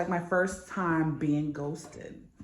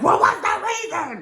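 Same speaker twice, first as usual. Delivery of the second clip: high, loud demand for an answer; high, louder pleading why; exasperated question; 10 semitones higher, 6 dB louder than usual. Retold or imitated. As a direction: cry out for a reason. Asking why you were ghosted.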